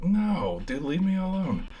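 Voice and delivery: high, drawn-out mock complaint; whiny protest. Him stumbling away from the guard, whiny protest. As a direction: act out pleading to be let go.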